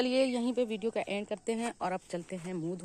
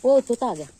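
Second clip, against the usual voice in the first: loud, higher burst of discovery; excited cry; 5 semitones higher, 13 dB louder than usual. Real, not acted. Vlogger spots a parrot landing in the garden, excited cry. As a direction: call out the sudden sighting.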